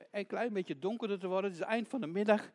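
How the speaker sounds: ordinary delivery, nothing marked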